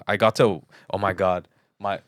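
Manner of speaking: ordinary delivery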